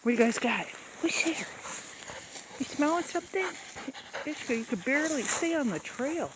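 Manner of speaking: high coaxing dog-talk voice. Real, not acted. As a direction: sweet-talk the dogs along. Talking to the dogs.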